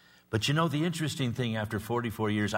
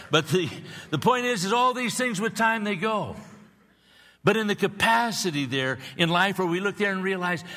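much higher and louder, fervent peak; soaring preaching; 9 semitones higher, 4 dB louder than usual. Acted. Pastor rousing the congregation, in soaring preaching. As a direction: lift the voice to a preaching crest.

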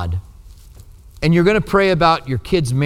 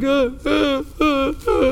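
high-pitched fake sobs; mock crying; 10 semitones higher, 3 dB quieter, slower than usual. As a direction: put on a showy little crying fit. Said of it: "and start crying".

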